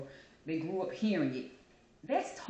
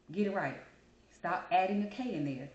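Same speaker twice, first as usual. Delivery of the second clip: usual speaking voice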